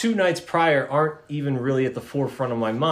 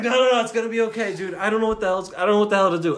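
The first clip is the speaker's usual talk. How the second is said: rapid, high, babbling mock panic; flustered reassurance; 6 semitones higher, much faster than usual. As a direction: try to calm a friend while panicking yourself. Acts out flustered reply to Barry.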